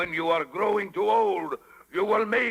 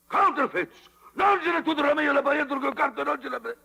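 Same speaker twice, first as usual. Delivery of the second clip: higher and louder, ranting in Italian; furious outburst; 9 semitones higher, 3 dB louder, faster than usual. Acted. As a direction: explode at the counterfeit coins. Stromboli finds fake coins.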